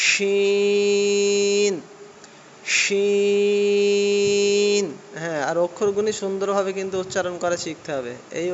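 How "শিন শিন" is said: শিন with a long hushing sh from the middle of the tongue; thick sh sound. Shown as a demonstration. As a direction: push the air out through the middle of the tongue on শিন. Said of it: "এরকমভাবে আসবে".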